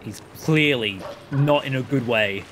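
normal talking voice

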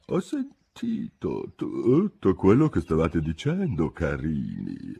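slow, croaky, drowsy drawl; hoarse little Dormouse voice; tiny hoarse voice. A narrator's reading. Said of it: "con una vocina roca roca".

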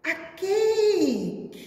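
high, loud gasp of delight; storybook wonder, up and loud; surprised. A narrator's reading. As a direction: reveal it like a big surprise.

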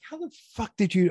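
high-pitched, baffled cussing at a buddy; mock incredulous yelling. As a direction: imitate a friend's disbelief, pitch jumping way up. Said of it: "he and I like to yell and cuss".

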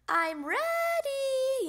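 high, loud, stretched-out shout; little frog hollering back; excited yell. A narrator's reading. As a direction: yell it back slowly and proudly. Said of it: "yelled Froggy".

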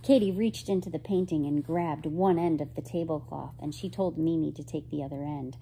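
ordinary delivery, nothing marked

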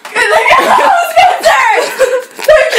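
laughing shout. Real, not acted. Laughing revenge.

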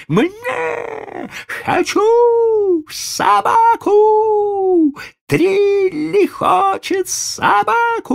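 very high, loud slow spoiled wail; tantrum child. A narrator's reading. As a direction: scream it out like a stamping brat. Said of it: "закатывался, топая ногами".

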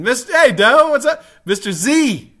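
loud, high-pitched hello; suddenly spot a friend and call out; excited shout-out. Real, not acted. Streamer spots another viewer, calls out.

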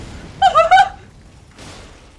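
high, loud mocking laugh; gleeful laugh. Real, not acted. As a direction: cackle at the stuck boss. Boss stuck, gloats.

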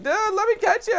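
very high, loud comic zombie; zombie's voice. Acted. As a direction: plead as a silly high-pitched zombie. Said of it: "This zombie's just like".

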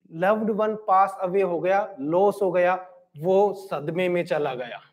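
low, slowed-down telling of a loss; subdued grief. Acted. Teacher telling students of someone sunk in shock, subdued. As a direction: let the voice sink, as if mildly grief-stricken.

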